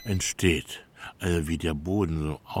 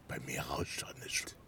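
whispered, barely voiced denial; a conspiratorial whisper to the neighbour; hushed denial; 8 semitones lower, 14 dB quieter than usual. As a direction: whisper it so nobody hears. Denies noise, whispered.

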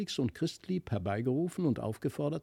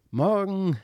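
calling from afar; 5 semitones higher than usual. A narrator's reading. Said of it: "rief es aus weiter Ferne".

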